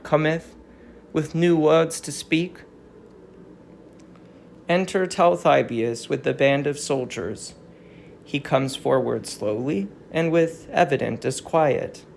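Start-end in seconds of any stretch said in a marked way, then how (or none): 4.7-12.0 low, even reading of the stage directions; lightly marked narrator voice reading the stage cue. A narrator's reading. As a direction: step back and read the directions plainly. Stage directions read aloud.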